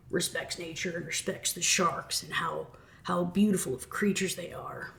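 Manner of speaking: soft, half-whispered awe; mock reverent whisper. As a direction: breathe it like a nature documentary, mocking. Mocking his nature talk.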